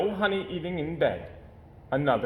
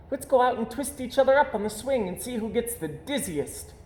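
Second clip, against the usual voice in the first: cub voice; 10 semitones higher than usual. Acted. Cub Sister voiced in story.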